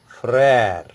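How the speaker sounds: "frere" drawn out slowly with an open eh; flattened vowel. Shown as a demonstration. French teacher giving another grave accent example, flattened vowel. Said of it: "Now another example".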